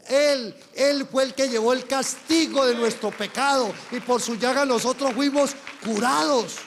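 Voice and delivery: fervent pulpit proclamation, driving the refrain; preaching at full force. Acted. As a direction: build the sermon to a ringing climax. Preaching climax, repeated "huimos.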